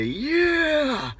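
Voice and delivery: a short, very high whoop; high excited whoop; cheering yeah. Retold or imitated. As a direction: join in the cheer with one burst. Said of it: "I wanted to say".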